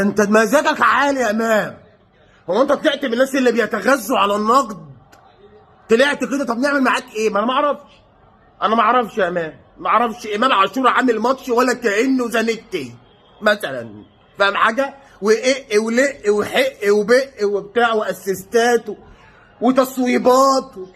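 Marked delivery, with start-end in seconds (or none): none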